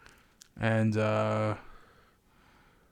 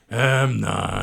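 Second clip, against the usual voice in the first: dim-witted Snake voice; 3 dB louder than usual. Retold or imitated. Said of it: "He sounds like he has one brain cell".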